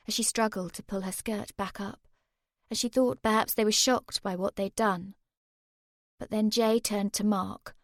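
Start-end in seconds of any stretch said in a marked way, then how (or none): none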